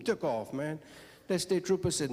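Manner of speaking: ordinary talk, nothing marked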